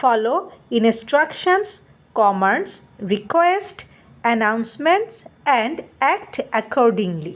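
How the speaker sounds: each English word set apart, very slow at the end; slow textbook reading. Shown as a demonstration. Teacher reading the unit goals to students, slow and spaced. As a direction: read the list out slowly, item by item. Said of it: "দেখো প্রথমটাই বলছে".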